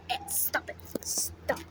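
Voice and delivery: hushed stop it, breathed out; whispered scolding. Real, not acted. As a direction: whisper a quick telling-off to the pet. Hushing the bird.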